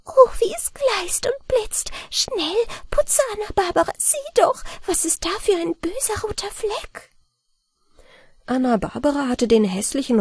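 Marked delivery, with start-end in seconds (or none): 0.1-7.1 very high, thin little-man voice; high mannikin voice, eager then alarmed; tiny mannikin calling. A narrator's reading. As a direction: squeak it out like a tiny gleeful gnome.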